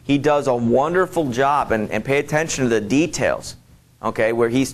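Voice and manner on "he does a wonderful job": ordinary talk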